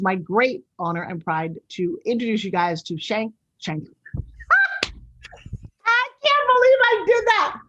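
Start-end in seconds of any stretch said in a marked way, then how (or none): 4.1-4.8 laughing at her own slip of the tongue; embarrassed high laugh. Real, not acted. Host laughs at mangling the guests' names, high embarrassed laugh. 4.8-7.6 high, slow words half-laughed out; giggling while correcting herself; laughing through words. Real, not acted. Host corrects the guests' names to them, laughing high and slow. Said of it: "did I make you guys laugh".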